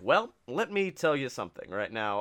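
low, measured promo talk; John Cena. Retold or imitated. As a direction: lightly imitate a wrestler's low drawn-in reply. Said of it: "he's like".

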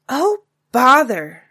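put-on a little girl huffing in mild frustration; childish mock exasperation. Taffy exasperated.

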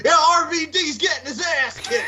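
high-pitched imitated yell; Undertaker. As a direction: holler it like a taunt to a crowd. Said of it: "Undertaker looks back and he goes".

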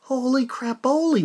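ordinary delivery

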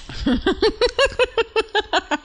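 high, rattling laugh; long laugh that rises and falls; manic laugh. Pretend. Reaction after asking for Xanax.

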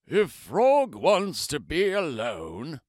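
slow lightly put-on turtle. Turtle answering Toad, slow turtle voice. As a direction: drawl it as a wise old turtle.

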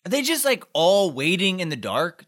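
normal talking voice

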